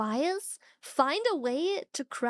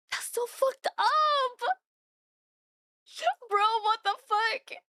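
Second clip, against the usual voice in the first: high, drawn-out disbelief; incredulous high outcry; outraged; 8 semitones higher than usual. Real, not acted. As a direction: exclaim it, appalled, with a laugh breaking in. Outraged at men told not to cry.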